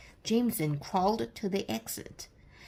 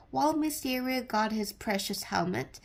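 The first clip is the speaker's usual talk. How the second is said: plain reading voice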